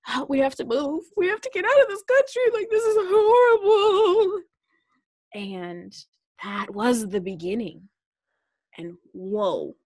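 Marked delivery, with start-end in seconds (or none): 0.1-4.4 high, fast, frantic pleading, getting louder; high frantic voice begging to flee the country; panicked plea. Retold or imitated. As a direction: replay the panic on the phone to mom. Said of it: "on the phone with my mom at some point being like".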